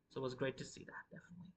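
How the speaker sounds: a quick breathy whisper to himself; whispered aside. Real, not acted. Host whispers an aside to viewers, hushed. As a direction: drop to a whisper. Quiet aside after the quote.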